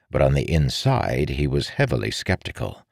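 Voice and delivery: ordinary delivery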